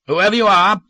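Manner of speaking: slurred shout from downstairs; drunken shout. Acted. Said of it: "Peter's slurred voice shouted".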